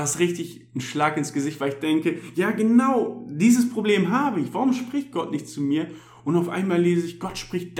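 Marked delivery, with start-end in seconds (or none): 2.3-5.9 excited recognition. Retold or imitated. Recalling his own reaction.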